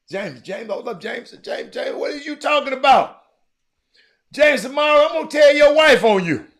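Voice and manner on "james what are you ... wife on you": teasing call-out. Real, not acted. Calling out a viewer by name.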